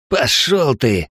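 slow, dismissive huff; scornful snort. Acted. Эл brushing off the admiral with a slow scornful snort. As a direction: spit it out with contempt. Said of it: "фыркнул Эл".

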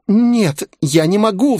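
very high, tearful female voice; sobbing refusal. A narrator's reading. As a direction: whimper it as the distraught young woman. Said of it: "всхлипнула она". (высокий плаксивый голос, всхлипывая)

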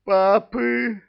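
very high, loud frightened croak. A narrator's reading. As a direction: croak in terror like a frog. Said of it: "испуганно квакнул шайтан". (испуганное кваканье)

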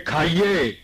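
a mild scolding command; mock scolding. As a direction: push it like a telling-off, lightly. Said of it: "ڈانٹ کے کہا؟".

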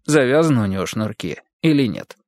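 ordinary delivery, nothing marked

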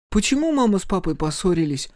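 put-on high-pitched child voice; little boy's question. A narrator's reading. Микки asks sister about parents' quarrel, childlike. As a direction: ask it as a puzzled small child.

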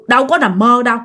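plain reading voice

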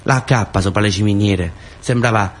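usual speaking voice

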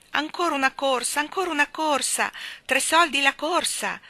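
loud, high fairground barker call; park keeper calling out. A narrator's reading. Park keeper calling riders for another go. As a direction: hawk the rides to the crowd. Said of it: "ricominciò il guardiano del parco".

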